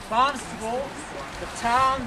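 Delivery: ordinary delivery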